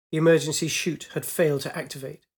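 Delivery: plain reading voice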